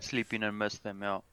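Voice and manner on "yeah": put-on Dutch accent. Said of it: "Sound a little Dutch there".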